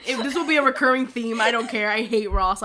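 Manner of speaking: loud, playful outburst of dislike; emphatic rant. Real, not acted. Podcaster declaring a running grudge against Ross, loud rant. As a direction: declare the grudge loudly and unapologetically.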